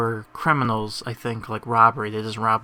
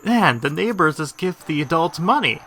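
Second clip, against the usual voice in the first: higher, louder mock amazement; retold amazed husband; 6 semitones higher, 6 dB louder than usual. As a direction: marvel in a lightly acted husband voice. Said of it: "tells Morticia".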